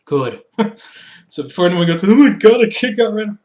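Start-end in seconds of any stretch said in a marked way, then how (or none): 2.0-3.4 high, loud pearl-clutching; mock outrage. Host mocking viewers upset over the car segment, mock outrage. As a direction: exclaim in hammed-up horror.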